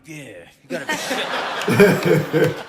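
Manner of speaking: low, loud, slow growly dog voice; the dog echoing its owner in a low, drawn-out voice; imitated dog. Dog in the corner copying its screaming owner, as a low dog voice. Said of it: "the dog was in the corner being like".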